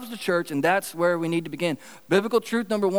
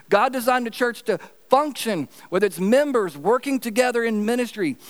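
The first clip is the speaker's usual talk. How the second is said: preaching; 5 semitones higher than usual. Acted. Preaching builds through repeated lines.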